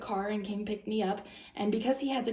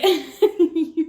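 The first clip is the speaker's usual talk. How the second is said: loud laugh; 8 semitones higher, 12 dB louder than usual. Real, not acted. Laughing at her own story.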